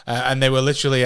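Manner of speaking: usual speaking voice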